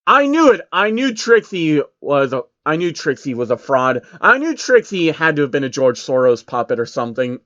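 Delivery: real excited reader reaction; excited outburst. Real, not acted. The reader breaks from the story, real excitement.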